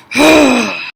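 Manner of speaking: big, loud, theatrical sigh; exasperated sigh. Acted. Leader worn out by all the complaining, heavy sigh. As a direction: let out a long fed-up breath.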